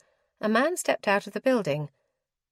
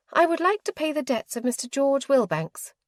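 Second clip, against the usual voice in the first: higher, prim lady's voice, businesslike; proper and firm, voiced a bit higher; formal young lady; 8 semitones higher than usual. A narrator's reading. Clarissa voiced, determined.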